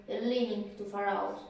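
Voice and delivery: plain reading voice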